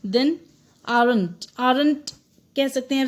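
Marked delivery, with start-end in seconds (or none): 0.9-2.1 aren't in two beats, "aa-runt"; sounded R. Shown as a demonstration. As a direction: say aren't with the R clearly pronounced. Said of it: "इसको आप aren't ना कहते हुए". (aren't को "आरंट" बोलें, र साफ़)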